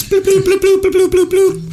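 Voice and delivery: very high playful bloop beeps; spoken morse code. Pretend. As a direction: chirp them like bleep sounds. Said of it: "sound like Morse code".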